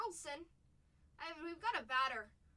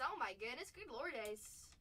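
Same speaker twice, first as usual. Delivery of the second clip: ordinary delivery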